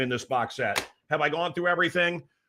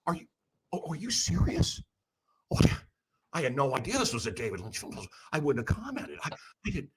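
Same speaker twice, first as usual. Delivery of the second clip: hushed, mock-apologetic politeness; overly nice; 6 dB quieter than usual. Apologizing to an offended festivalgoer, overly nice. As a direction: gush softly with exaggerated innocent concern. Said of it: "my new thing that I do is I'm overly nice".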